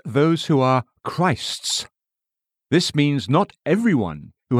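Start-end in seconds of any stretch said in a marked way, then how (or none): 0.1-1.9 "who" lifted and held, slow and deliberate; stressed phrase. Shown as a demonstration. Preacher pointing readers to the words, slow, stressing "who". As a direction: spell out "those who are Christ's" word by word, slowly. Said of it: "Notice the words".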